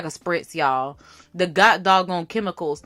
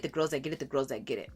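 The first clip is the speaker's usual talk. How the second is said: echoing the phrase, much softer each time; fading repeat; 8 semitones lower, 12 dB quieter, much faster than usual. Real, not acted. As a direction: let it trail off into a soft echo.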